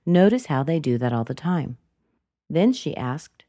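ordinary talk

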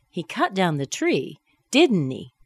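"didn't he" falling, confident; falling tag for confirmation. Shown as a demonstration. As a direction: drop DIDN'T HE as if only confirming. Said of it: "I'm asking for confirmation".

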